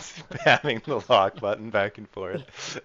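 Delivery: loud laughing, slowed words. Real, not acted. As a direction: laugh through the words loudly. Laughing at spamming lock.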